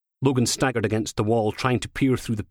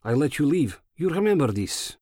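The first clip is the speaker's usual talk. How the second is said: put-on higher-pitched, thick Eastern European accent; heavy Eastern European accent; 5 semitones higher than usual. A narrator's reading. Gunman warning as he lets him go, heavy Eastern European accent. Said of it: "in a heavy Eastern European accent".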